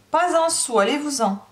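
high, loud refusal; angry dismissal. Acted. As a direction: cut her off and send her packing. Madame angrily dismisses the servant.

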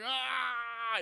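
long, high mock scream; acted cry of a burning monster; pained scream. Imitating burning ape.

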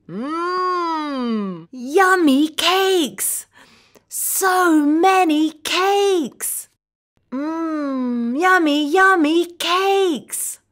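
slow, high-pitched yummy delight; slow singsong treat-lover; savoring delight. Acted. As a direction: relish every word, drawn out for little kids. Relishing cakes for young learners.

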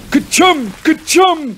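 two high, loud play-acted sneezes; mock double sneeze. A narrator's reading. Joe pretending to be Billy on peppered worm, mock sneezes. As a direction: sneeze twice, theatrically. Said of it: "cried Joe".

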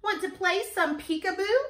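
high, loud playful cat invitation; storybook cat. A narrator's reading. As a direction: ask brightly high, as the cat. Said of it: "says Cat".

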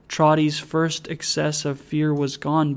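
ordinary delivery

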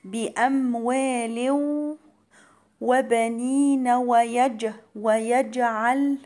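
"بأموال وبنين" chanted slowly, hummed into the w; merging with ghunna. Shown as a demonstration. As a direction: recite "بأموال وبنين" with a held nasal glide, bouncing the ج of "ويجعل". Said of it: "هنا هيبقى فيها إدغام بغنة". ("بأموال وبنين" بإدغام وغنة)

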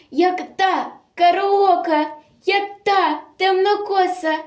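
high, stretched-out astonished exclamation; shocked disbelief. Acted. Commandant sees the executed girl again, shocked disbelief.

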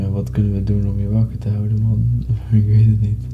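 ordinary talk

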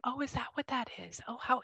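breathy whisper of surprise; surprised whisper. Real, not acted. Whispering to the client about her necklace, surprised. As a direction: whisper it, caught off guard.

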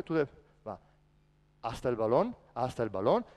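usual speaking voice

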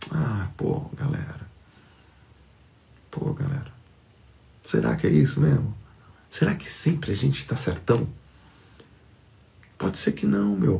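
voz baixa e íntima, puxando a reflexão; quase sussurrando. Real, not acted. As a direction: fale baixinho, como um segredo. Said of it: "fala baixinho refletindo". (sussurro reflexivo para a galera)

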